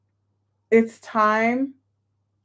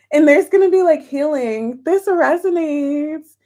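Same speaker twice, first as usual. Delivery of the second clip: emotional; 5 semitones higher, 5 dB louder than usual. Real, not acted. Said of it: "why I'm getting emotional".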